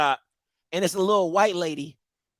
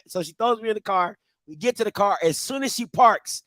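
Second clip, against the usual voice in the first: normal talking voice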